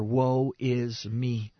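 very slow drawn-out confession. Retold or imitated. Preacher voicing Isaiah giving up, slow drawn-out lament. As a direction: stretch each word out like a heavy admission.

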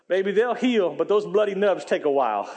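quoting her while breaking into laughter; can't hold the laugh back on the punchline; chuckling through a lightly voiced older lady; laughing through quote. Retelling lady's line, laughing.

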